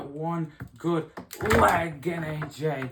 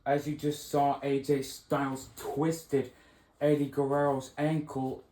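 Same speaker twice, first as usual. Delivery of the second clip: announcer update; 3 semitones lower than usual. Acted. Announcer gives injury update.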